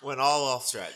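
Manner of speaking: loud, real laugh running into the words; high, loud and amused. Real, not acted. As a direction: laugh at yourself for rambling. Laughing at his own digression.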